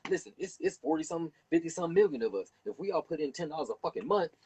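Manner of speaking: high, fast, pumped-up organizer; rallying pitch. Retold or imitated. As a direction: fire up the crowd with the money plan. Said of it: "we'll get together and say".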